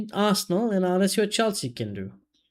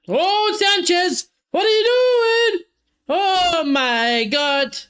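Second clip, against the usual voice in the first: much higher, louder, slow drawn-out cry of disbelief; disbelieving shout; 12 semitones higher, 5 dB louder, much slower than usual. Real, not acted. Fan yelling at Sanchez's blunder in real disbelief. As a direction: wail at the keeper's howler.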